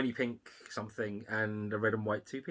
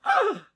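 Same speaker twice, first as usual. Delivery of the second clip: high, loud "ah!"; sudden high yelp, acted out; retold gasp of recognition; 8 dB louder than usual. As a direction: re-enact a delighted shriek of recognition. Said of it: "I just turned around and went".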